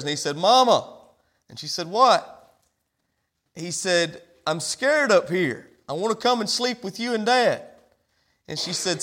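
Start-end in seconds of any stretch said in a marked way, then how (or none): 0.4-0.9 put-on higher, louder little-boy call; calling from upstairs. Little boy calls his mother from upstairs, calling out. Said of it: "heard the little boy calling from upstairs". 1.9-2.5 raised, higher one-word answer; calling back. Retold or imitated. Mama answers her boy upstairs, calling back. 4.5-7.6 a hint of a child calling down; lightly voiced scared boy. Little boy upstairs tells mama he's afraid, mildly plaintive calling.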